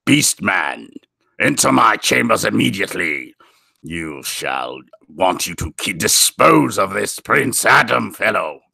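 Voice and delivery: low, imposing villain command; slow, deep, menacing voice; dark skeleton voice. Acted. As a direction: order a minion like a dark overlord. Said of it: "some dark skeleton voice".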